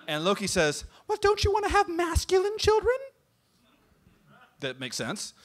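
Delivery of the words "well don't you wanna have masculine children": falsetto bridesmaid. Acted. Loki as bridesmaid.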